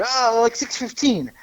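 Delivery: high, put-on fake Italian voice; mocking wiseguy voice; Fat Shitso impression. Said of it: "fake Italian guy".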